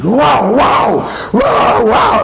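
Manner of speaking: high shouted mock lion roar; a storyteller's hammed-up lion roar.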